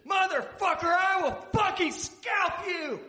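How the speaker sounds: very high, loud screamed threat; hammed-up female fury. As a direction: scream it, stretching out the end. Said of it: "unbridled female fury".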